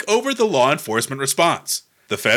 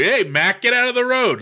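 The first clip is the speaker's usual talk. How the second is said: higher-pitched mock cab-driver call; 9 semitones higher than usual. Cabbie to a pedestrian in the road, calling out. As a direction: call out like an old-time cabbie. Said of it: "all cab drivers called anybody".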